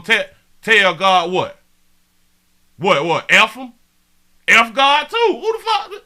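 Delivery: loud, rising disbelief turning into a laugh; incredulous challenge. Real, not acted. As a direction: confront the chatter, daring him. Streamer confronting a chatter.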